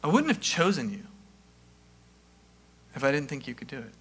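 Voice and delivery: soft, weighty repeat. Shown as a demonstration. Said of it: "I just want that to sink in".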